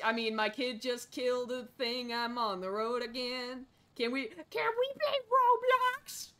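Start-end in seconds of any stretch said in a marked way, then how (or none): 4.0-6.3 put-on high, slow childish whine; whiny kid voice. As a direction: beg like a bored child. Voicing a whining kid.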